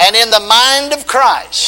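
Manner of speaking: loud, high, very slow call for the crowd to repeat; preaching call. Acted. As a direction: chant it out line by line. Call and response.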